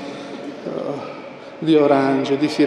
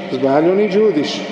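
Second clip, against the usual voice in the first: ordinary talk, nothing marked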